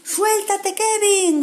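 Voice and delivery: high urgent call; friends shouting. Acted. Friends shout to Kevin to let go, urgent. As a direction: urge him to let go. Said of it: "gritaban los amigos".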